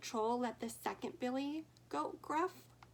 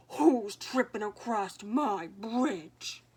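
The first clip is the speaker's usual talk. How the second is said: loud, slow booming troll; troll roar; 8 dB louder, slower than usual. Acted. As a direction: boom the challenge from under the bridge. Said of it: "the troll roared".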